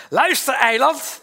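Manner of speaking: loud, high, slow and laughing. Real, not acted. Preacher joking to the congregation about his island, laughing. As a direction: laugh through the words at your own joke. Jokes about living on Noordereiland.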